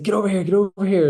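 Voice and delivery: high, loud, fast frantic yell; urgent retold call across the ward; imitated panicked shout. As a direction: bark it like a junior doctor losing his head. Panicked junior doctor at arrest.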